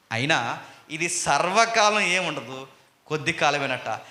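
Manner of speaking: normal talking voice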